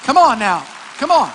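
loud, punchy preacher's call; rousing shout. Real, not acted. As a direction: fire up the congregation with short shouts. Calls out to rouse congregation.

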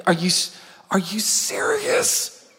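drawn-out, hammed-up disbelief; incredulous brother. Brothers reacting to David being fetched, incredulous. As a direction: stretch it slowly in mock outrage.

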